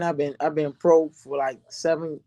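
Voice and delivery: ordinary talk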